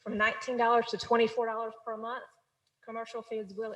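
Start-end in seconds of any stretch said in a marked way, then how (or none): 0.0-2.2 louder, projected presentation voice; speaking up. Real, not acted. Finance officer repeating fee figures for the council, speaking up. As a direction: speak up so the back rows hear. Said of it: "speak up just a little bit".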